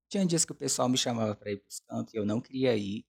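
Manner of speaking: ordinary talk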